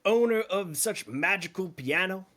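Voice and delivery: lightly voiced ship cook, curious; a hint of the jolly cook.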